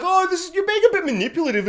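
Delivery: high, fast mocking sneer; high sarcastic impression of Siobhan. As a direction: mimic her as a shrill, dismissive sister. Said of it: "she's like".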